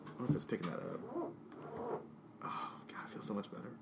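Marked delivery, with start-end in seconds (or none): 1.1-3.7 soft whispered sigh of relief. Real, not acted. Host relieved after fixing the chair, whispered. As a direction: breathe it out, relieved.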